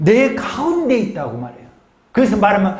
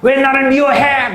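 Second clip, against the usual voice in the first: imitating a pastor's sudden loud outburst, higher; retold angry yell; 7 semitones higher, 4 dB louder than usual. Older pastor yelling at the young preacher who confessed, imitated loud outburst. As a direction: snap back loudly as the offended pastor. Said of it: "소리를 버럭 지르는 거예요".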